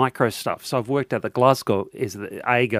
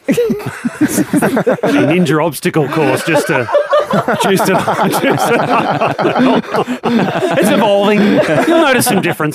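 laughing; 7 semitones higher, 4 dB louder, slower than usual. Real, not acted. Laughing through joke.

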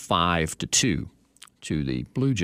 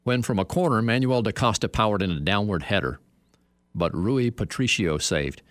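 measured recital with stretched phrase endings; dramatic copy reading; 5 semitones higher than usual. Acted. Host testing odd soccer copy on his co-host, theatrically. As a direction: read it out grandly, landing hard on "went close". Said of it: "how about this sentence".

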